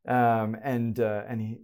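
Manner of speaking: normal talking voice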